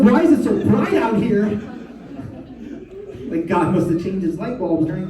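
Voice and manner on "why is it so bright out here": put-on high child voice. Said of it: "She's like".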